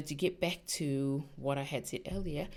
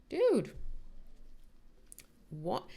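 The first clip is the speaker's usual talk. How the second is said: incredulous; 3 semitones higher than usual. Retold or imitated. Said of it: "And I'm like".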